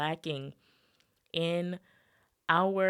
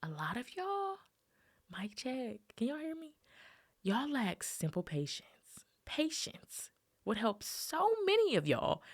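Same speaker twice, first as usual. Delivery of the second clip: playful mock whisper; 6 semitones higher than usual. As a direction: lean in and whisper the secret. Jokey mic check, secret aside.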